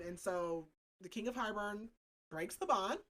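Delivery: usual speaking voice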